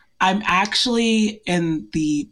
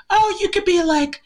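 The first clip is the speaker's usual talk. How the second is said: high, mock-eager pitch of an idea; siblings' eager suggestion; 12 semitones higher than usual. Siblings pitching handle names in the group chat, mock-eager. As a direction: imitate siblings tossing out an idea, voice lifted high.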